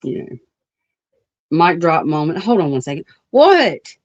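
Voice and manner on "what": calling out. Real, not acted. Answers cousin calling off-mic.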